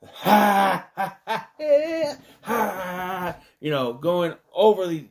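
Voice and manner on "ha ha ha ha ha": high forced ha ha laughter; mock overdone laugh. Reviewer imitating Buddy's overdone laugh, loud high ha ha. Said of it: "He's overly laughing".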